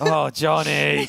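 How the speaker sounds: loud, high, drawn-out laughing wail; mock exasperated cry. Player laments teammate Johnny's rune blunder, mock despair. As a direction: wail the name in comic despair.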